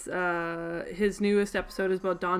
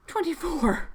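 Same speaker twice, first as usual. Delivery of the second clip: higher, astonished repeat of the number; amazed exclamation; 9 semitones higher than usual. Real, not acted. Vlogger marveling at grandpa's age, amazed. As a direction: say it again, stunned by it. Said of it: "My grandpa was twenty-four".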